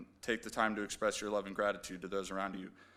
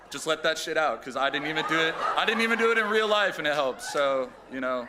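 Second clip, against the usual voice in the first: high, loud, laughing while crying; laughing through tears; 6 semitones higher, 9 dB louder than usual. Real, not acted. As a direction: crack up mid-sentence through tears.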